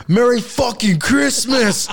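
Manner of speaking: slow, high, loud mock snarl; tweaked-out stepdad yell. Stepdad bursting out of the room, slow mock yell. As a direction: drag it out like a wired, angry boyfriend. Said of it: "He goes".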